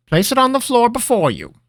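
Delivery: high, loud wizard voice; brisk like a wizard master; old wizard voice. Acted. Master instructing.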